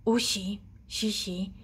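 ordinary delivery

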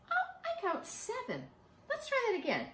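high, amused surprise at the wrong count; playful oh, I got it wrong; surprised recount. Real, not acted. Miscounted leaves.

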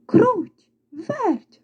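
high, drawn-out call of names; rooster calling out. A narrator's reading. Rooster calling the mice to see his find, calling out. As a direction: call two playmates over. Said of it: "покликав півник".

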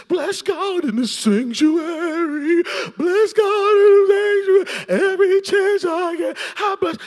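high, loud, slow sung praise; Mary worshipping. Retold or imitated. Mary at Jesus' feet, singing praise in a high loud voice. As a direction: lift it up like ecstatic worship, half singing. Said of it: "Mary, her sister, is sitting before Jesus".

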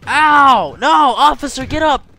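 pained shouting. Real, not acted. Gamer beaten up, shouting loud and high for the guard. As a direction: yell in pain and call the guard.

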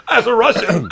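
high, loud burst of laughter over the words; laughing hard. Real, not acted. Guest laughing at the host's dramatic reading, laughing hard and loud. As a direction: crack up mid-phrase.

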